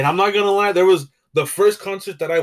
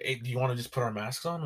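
much softer, discreet question; hushed suggestion; 3 semitones lower, 12 dB quieter than usual. Retold or imitated. Asking his girlfriend to mask up, hushed. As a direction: quietly suggest it so nobody hears. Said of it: "I was like".

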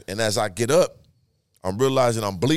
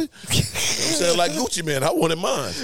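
high put-on rapper voice; 6 semitones higher than usual. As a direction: slip into a playful rapper voice. Riffing on [name].

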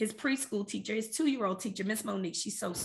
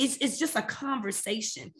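normal talking voice

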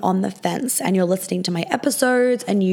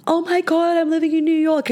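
much higher, gushing mock thrill; mock excited squeal; 9 semitones higher than usual. Mocking the idea of being amazed at living in New York. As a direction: parody a giddy dream come true. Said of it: "it was never a".

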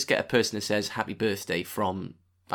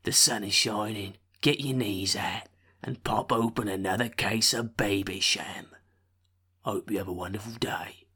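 put-on London accent. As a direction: read it like a Londoner. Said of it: "Just do, like, London".

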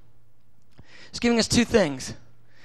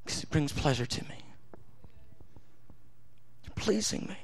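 lower, much softer tender coaxing; voicing God; 4 semitones lower, 5 dB quieter than usual. God coaxing a guilty believer to pray, softly. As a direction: gently coax the little fellow. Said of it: "God goes".

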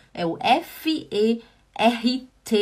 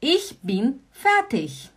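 slow, careful Ich bin fertig; slow model phrase; 3 semitones higher, much slower than usual. Shown as a demonstration. Teacher slowly modelling the phrase for students. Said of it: "Also gut".

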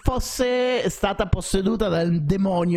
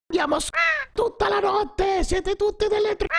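retold hysterical screaming. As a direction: scream it like a woman losing it. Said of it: "ha cominciato ad urlare".

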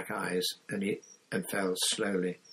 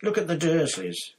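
furious; 8 semitones higher, 4 dB louder than usual. A narrator's reading. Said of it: "Harry said furiously".

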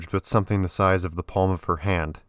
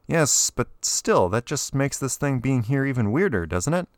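Elliot, puzzled; 7 semitones higher than usual. A narrator's reading. Elliot pressing the woman about the rare Ansible, higher puzzled.

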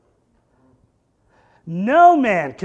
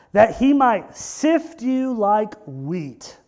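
louder, slow preached scripture; preaching Jesus' words; 4 dB louder, slower than usual. Acted. Preacher voicing Jesus warning Peter, slow preaching. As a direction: proclaim it slowly, full voice.

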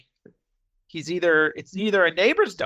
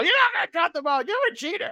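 mock yelling; 13 semitones higher, faster than usual. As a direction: accuse him of cheating at a high yell. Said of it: "they just yell at each other".

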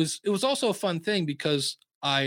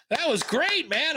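high, hammed-up bandmate praise; mock the bandmates' easy approval; bandmates impressed; 12 semitones higher, slower than usual. Bandmates praising the first take, high mock praise.

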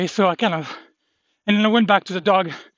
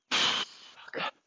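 whispered curse; 10 dB quieter than usual. Real, not acted. Curse under breath.

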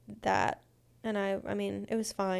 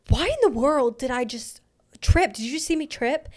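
louder, higher, baffled outburst; indignant disbelief, pitched up; exasperated; 4 semitones higher, 5 dB louder than usual. Retold or imitated. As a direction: demand an explanation for the stumble. Exasperated retelling of tripping.

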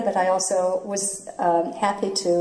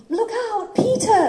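put-on high girlish warning cry; Wendy calling out. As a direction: call out to Peter, alarmed. Recalls Wendy line from show.

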